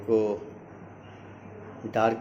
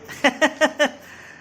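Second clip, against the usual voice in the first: rapid high-pitched giggles; high giggling laugh; 12 semitones higher than usual. Real, not acted. Dad amused by the child's answer, high giggling laugh.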